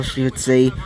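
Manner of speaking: plain reading voice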